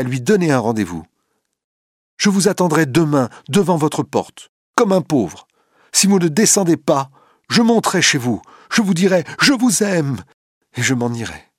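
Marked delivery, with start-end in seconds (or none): 2.2-11.4 insistent. A narrator's reading. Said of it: "insiste Duroy".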